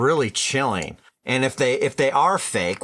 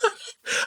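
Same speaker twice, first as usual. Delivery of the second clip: high, breathless giggle; helpless laughter after the silly imitation; real uncontrollable giggling; amused laugh; 4 dB quieter than usual. Real, not acted. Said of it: "it made me laugh more than scaring me".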